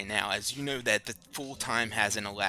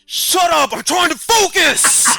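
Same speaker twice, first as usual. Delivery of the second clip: much higher, louder yelling, slow; frustrated shout; 16 dB louder, slower than usual. Retold or imitated. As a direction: snap at him at full volume. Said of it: "You're like".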